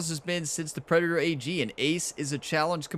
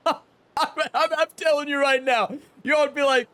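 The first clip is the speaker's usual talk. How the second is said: excited; 11 semitones higher, 5 dB louder than usual. Real, not acted. Giddy after near ace.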